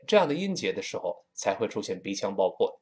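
normal talking voice

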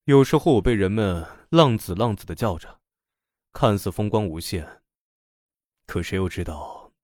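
low, regretful lament; understated sorrow, sinking at the ends; wistful. A narrator's reading. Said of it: "眼神凄迷地说道".

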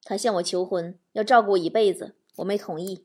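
low, soft deadpan; quiet nonchalant storyteller's punchline; calm, unfazed. Retold or imitated. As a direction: say it coolly, as if it were nothing. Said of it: "我淡定地说".

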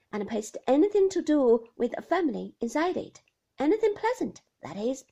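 high, loud, chirpy enthusiasm; gushing nurse. Acted. The nurse tells Mrs de Winter about the scrapbook, high and gushing.

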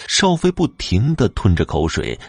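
plain reading voice

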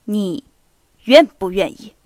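低沉而响亮地追问; 不耐烦地再问一遍; 严厉逼问. Acted. 老公爵再次逼问. (老公爵低沉响亮地逼问)

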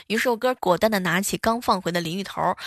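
ordinary delivery

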